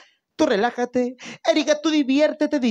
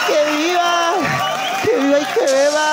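festive cheer; 3 semitones higher than usual. Real, not acted. Closing birthday cheer.

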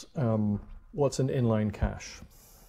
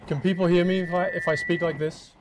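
louder, higher testing voice; loud mic test; 5 semitones higher, 9 dB louder, faster than usual. Shown as a demonstration. Speaker testing the mic for the room, projecting loudly. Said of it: "Or do I need to speak like this?".

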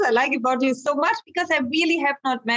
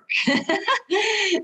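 bright burst of laughter; high laugh; 10 semitones higher than usual. Real, not acted. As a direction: laugh out at the memory. Laughs at own memory.

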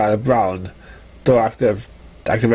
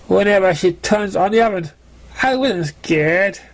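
higher, airy voice waving the danger away; boastful young filly Scooter; 7 semitones higher than usual. Acted. Scooter brushing off Sparkler's fear, higher breezy boasting voice. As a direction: brag that it was nothing, a little too fast to be true.

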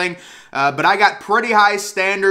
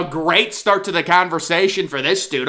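ordinary talk, nothing marked

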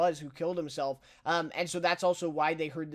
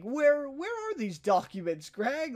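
higher, slowed mock-friendly ask; imitating Tom; 7 semitones higher, slower than usual. Tom fishing Greg for the documents, higher slowed mock-friendly. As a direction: play it off while fishing for them. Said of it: "in this delivery, he's just awesome".

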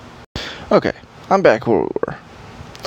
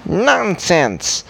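indignant elf; 6 semitones higher than usual. Acted. Elf character protesting.

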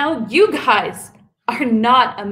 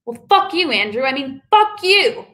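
FUCK snapped high at Andrew; angry outburst; 6 semitones higher than usual. Acted. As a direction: spit it at him.

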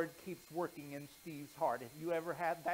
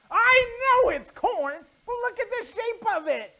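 exasperated mom; 15 dB louder than usual. Acted. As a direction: press the boy to admit it. Mom confronts Steve about the doll.

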